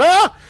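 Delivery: sharp high scream from behind a door; small muffled scream. Acted. Unseen survivor screams in terror behind the door. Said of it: "you hear a small scream".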